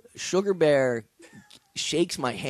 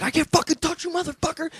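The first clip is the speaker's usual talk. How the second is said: imitated screaming; faster than usual. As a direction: yell it like the attacker kicking the car door. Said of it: "He's screaming".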